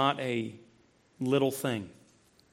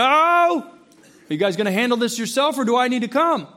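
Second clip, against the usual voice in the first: raised, louder dad ultimatum; 6 semitones higher, 5 dB louder than usual. Retold or imitated. Dad warning squabbling kids from afar, raised stern voice. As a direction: put the choice to the kids firmly. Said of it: "That's my dad voice a little bit".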